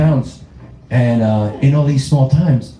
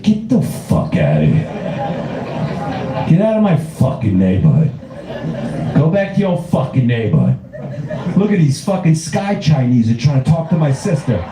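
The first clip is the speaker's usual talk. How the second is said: Brooklyn Italian guy. Acted. Brooklyn Italian shooting at invading aliens, indignant warning. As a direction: warn intruders off like a Brooklyn tough. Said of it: "They'd just be shooting at it like".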